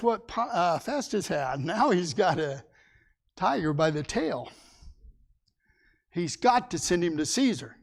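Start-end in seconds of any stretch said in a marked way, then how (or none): none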